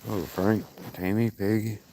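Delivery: ordinary delivery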